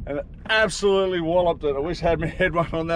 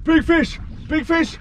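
excited; 11 semitones higher than usual. Real, not acted. Excited call at hooked fish.

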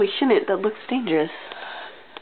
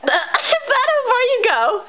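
high laughing disbelief; cracking up while asking; 12 semitones higher, slower than usual. Real, not acted. Laughing at friend's splits.

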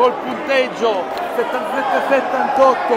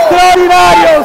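shouted exclamation; 8 semitones higher, 15 dB louder, slower than usual. Real, not acted. Repeated exclamation.

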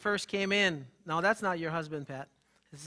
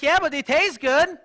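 whiny excuse; 9 semitones higher, 11 dB louder than usual. Retold or imitated. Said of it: "He's like".

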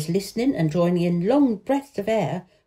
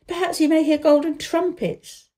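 playful; 8 semitones higher than usual. A narrator's reading. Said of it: "though he laughed".

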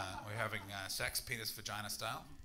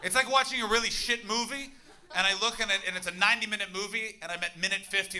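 high, loud exasperated rant; loud comic rant; 7 semitones higher, 10 dB louder than usual. Real, not acted. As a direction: rant at the crowd, fed up. Ranting about bad gig.

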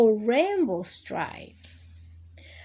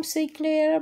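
ordinary delivery, nothing marked

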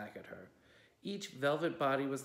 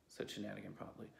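usual speaking voice

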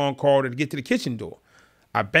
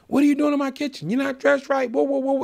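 high-pitched mock yell; imitated yelling; 11 semitones higher than usual. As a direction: mimic an angry man challenging an intruder. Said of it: "He's yelling at me".

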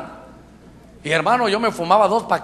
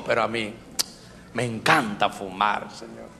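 mock confession; 9 semitones lower, 9 dB quieter than usual. Preacher retelling his frank prayer about loving cigarettes, low and sheepish. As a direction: confess it quietly, dropping low.

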